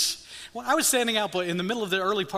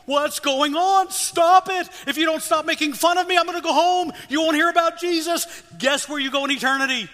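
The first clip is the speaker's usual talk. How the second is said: put-on loud, high, exasperated yelling at the kids; frustrated shout; 11 semitones higher, 9 dB louder than usual. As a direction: shout it out, fed up and pleading. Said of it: "And I was frustrated".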